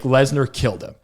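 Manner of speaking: ordinary talk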